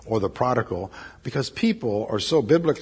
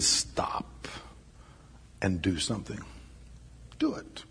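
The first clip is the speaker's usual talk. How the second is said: whisper; 4 semitones lower, 11 dB quieter than usual. Real, not acted. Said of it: "hushed urgent appeal".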